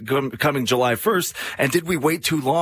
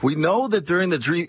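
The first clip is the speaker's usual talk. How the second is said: ordinary talk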